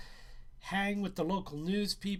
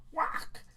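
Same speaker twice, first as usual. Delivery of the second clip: whispered high whack; breathy slap noise; smack sound; 12 semitones higher than usual. Acted. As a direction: spit out a sharp whack. Narrator mimics a smack.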